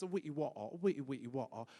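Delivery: mock posh British mumble; a hint of English teacher babble; English accent parody. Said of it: "they speak like this".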